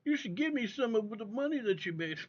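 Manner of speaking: lightly voiced mocking imitation; mocking a streamer. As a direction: mimic a beggar for cash. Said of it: "It's like".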